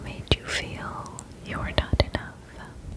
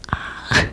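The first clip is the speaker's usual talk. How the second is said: breathy whispered laugh; 4 dB louder than usual. Real, not acted. Laughs at being moved.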